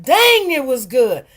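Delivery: loud, slow, savoring cry of delight; retold loud rave about the meal; excited exclaim. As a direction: belt out how good it was.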